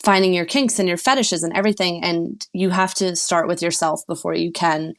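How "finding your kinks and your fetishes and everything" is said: normal talking voice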